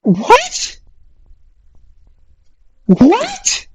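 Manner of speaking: high, stunned question; shocked what. Real, not acted. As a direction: gasp it out in disbelief. Shocked at game.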